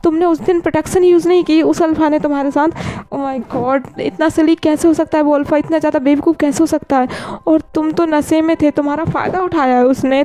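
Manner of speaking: high, outraged friend voice; indignant friend. Acted. Friend rages that the alpha took advantage of Wing, high and indignant.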